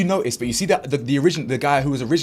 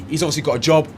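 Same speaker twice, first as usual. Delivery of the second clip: ordinary talk, nothing marked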